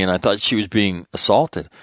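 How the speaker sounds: usual speaking voice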